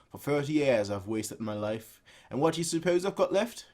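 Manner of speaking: ordinary delivery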